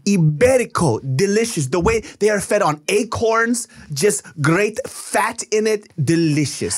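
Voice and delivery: put-on Italian accent. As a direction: relish each word in an Italian accent. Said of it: "we did an Italian accent".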